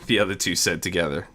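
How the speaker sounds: plain reading voice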